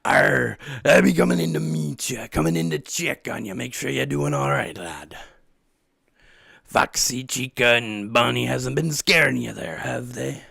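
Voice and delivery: hammed-up pirate accent, gruff and playful. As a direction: growl it like a pirate captain. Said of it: "He's a pirate. He should be more like".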